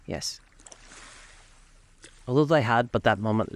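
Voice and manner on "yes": short, subdued, low reply; brief, uneasy answer. A narrator's reading. Scrooge, uneasy in his mind, answers the ghost: brief and subdued. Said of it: "answered briefly".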